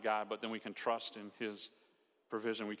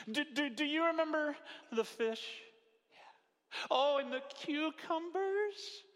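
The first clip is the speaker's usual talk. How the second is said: whiny Israelites. Retold or imitated. Complaining Israelites, acted out.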